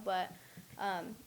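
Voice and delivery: usual speaking voice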